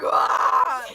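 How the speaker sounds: loud anguished yell "Ah!"; frustrated scream. Retold or imitated. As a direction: scream out in defeat. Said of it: "And she's just like".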